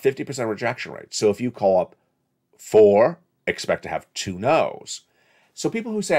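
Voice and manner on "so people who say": ordinary talk, nothing marked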